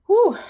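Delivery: high, loud release of breath; emotional exhale. Real, not acted. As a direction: let a wave of feeling out in one breath. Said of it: "A wave just went through me".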